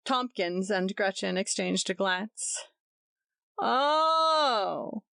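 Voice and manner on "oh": higher, drawn-out ironic oh; ironic exclamation. A narrator's reading. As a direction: exclaim with mock surprise. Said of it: "exclaimed Tompkins ironically".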